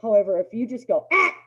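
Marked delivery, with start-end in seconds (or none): 1.1-1.3 short loud sharp "Ah". Shown as a demonstration. Trainer demonstrating the startling "Ah" to owners. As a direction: snap a startling "Ah" at the dog. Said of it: "just a loud, sharp".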